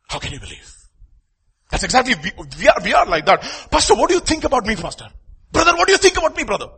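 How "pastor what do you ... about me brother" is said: high, loud, pleading voice asking everyone about himself; needy approval-seeker. Pretend. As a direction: beg for reassurance from pastor, wife and friend. Needy person begging everyone's opinion.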